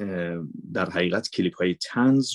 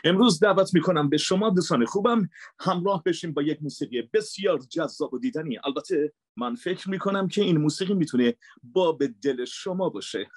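higher, bright presenter read of a music show; dubbed show host voice; 8 semitones higher than usual. Shown as a demonstration. Voice actor demos his dubbed music host, presenter voice.

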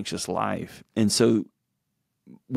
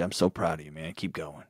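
soft, lower, eyes-down mumble of pride; a hint of the awkward uncle, quietly proud; awkward quiet praise; 5 semitones lower, 8 dB quieter than usual. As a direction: say it shyly, not looking at him. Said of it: "he wouldn't look you in the eyes kind of way".

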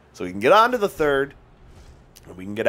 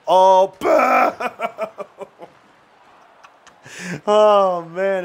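playful boo; 7 semitones higher, 6 dB louder, much slower than usual. Real, not acted. Booing a play.

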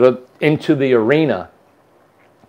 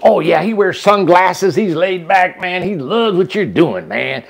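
higher-pitched, drawn-out gushing assurance; imitated breezy enthusiasm; 5 semitones higher, slower than usual. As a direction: play her as a delighted, easygoing believer. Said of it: "That's what she would do".

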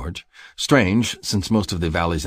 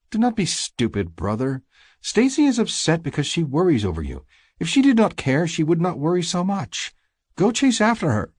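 high-pitched sisterly scolding; high, insistent sister voice; exasperated sister; 12 semitones higher, 3 dB louder than usual. Acted. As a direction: scold your brother in a much higher voice.

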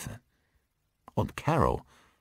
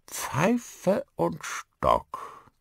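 put-on very slow, high old-man voice; high, drawn-out character voice; old Northern English man; 13 semitones higher, much slower than usual. A narrator's reading. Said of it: "mit eher nordenglischem Akzent".

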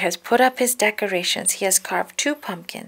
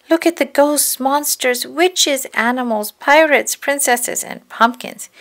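higher, lively, each item lifted; excited pointing-out; 6 semitones higher, 4 dB louder than usual. A narrator's reading. Reader showing children the Halloween parade, excited. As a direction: marvel at every new sight.

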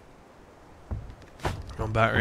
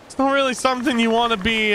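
ordinary delivery

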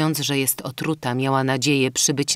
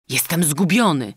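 ordinary delivery, nothing marked

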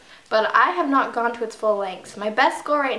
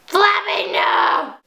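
loud, raised cry of protest; dismayed shout; 9 semitones higher, 4 dB louder, much slower than usual. Real, not acted. Bird dies in game.

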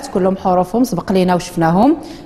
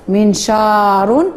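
منشار stretched slowly, syllable by syllable; slow model reading; much slower than usual. Shown as a demonstration. Teacher modelling a word for the class, very slowly. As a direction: draw out منشار slowly for the pupils. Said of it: "وتنقراو بالفصحى منشار".

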